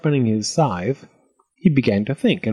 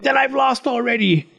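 high, wailing goblin voice; dismayed goblin squeal; shrieking goblin; 12 semitones higher than usual. A narrator's reading. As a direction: wail in defeat as a goblin. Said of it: "shrieked the goblin".

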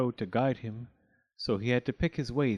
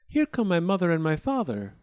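high, raised announcement; exclaiming; 6 semitones higher, 4 dB louder than usual. A narrator's reading. Ingeborg sees her parents coming, exclaiming. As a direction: exclaim it, pitched up. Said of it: "exclaimed Ingeborg".

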